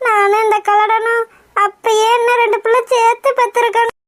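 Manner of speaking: slow, drawn-out tearful lament; wailing lament. Acted. Grandmother grieves that her children have nobody left, wailing lament. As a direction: stretch the phrases into a mournful wail. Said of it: "எதுக்காக இப்படி ஒப்பாரி வச்சிட்டு இருக்கீங்க?".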